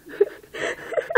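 breathy giggle; amused laugh without words. Real, not acted. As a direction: giggle helplessly. Breaks up reading.

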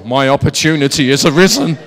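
loud, hammed-up declaration; comic booming proclamation; mock triumphant wife. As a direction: imitate a wife seizing her chance. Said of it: "This is where wives go".

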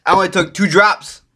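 ordinary delivery

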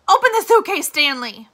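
high, loud barked command; shouted order. A narrator's reading. Warden orders Stanley to open the suitcase, shouted command. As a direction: yell it at him, no patience left.

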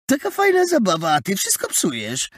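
high, whiny protest; boyish complaint. A narrator's reading. Boy complains his fun is spoiled.

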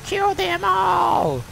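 very high, drawn-out mock war scream; screamed mock battle cry. Gamer playing at ordering a massacre, frenzied mock scream. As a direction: stretch every word into a high scream.

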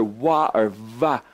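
WA with rounded W, VA with buzzing V; letter sound demo. Shown as a demonstration. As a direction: sound out WA and VA as two alternatives. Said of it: "and this one is either".

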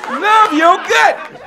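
high, drawn-out shout; slow booming chant; shouted slogan. Acted. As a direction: belt it out like a rally chant. Opening slogan shouted to crowd.

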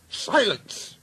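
high, sudden order for silence; commanding order. Acted. Boss ordering squabbling engines quiet, commanding. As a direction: cut them off with authority. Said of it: "ordered a well-known voice".

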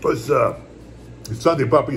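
ordinary delivery

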